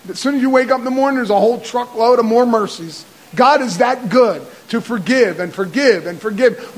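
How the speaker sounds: fervent sermon delivery; preaching. Acted. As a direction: preach it with building fire.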